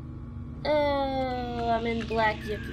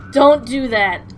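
shaken plea; 9 dB louder than usual. Real, not acted. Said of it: "I have this insane fear of heights".

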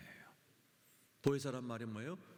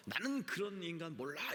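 higher-pitched mock denial; retold cursing denial; 6 semitones higher than usual. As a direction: imitate an emphatic, swearing denial. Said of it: "뭐를 막 욕하면서". (욕하듯 잡아떼는 흉내)

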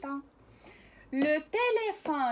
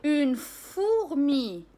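slow reading; 4 dB louder, much slower than usual. Shown as a demonstration. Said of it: "لقراءة بعض الكلمات".

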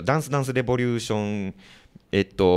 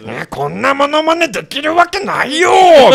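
much higher, louder, slow cartoon cat voice; Nyanchu impression; 12 dB louder, slower than usual. Host doing the cartoon cat to complain about the impressions. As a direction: squeak it out like the NHK puppet cat. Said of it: "にゃんちゅうとか書いてくればいいのにね".

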